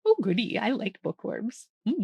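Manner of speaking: ordinary delivery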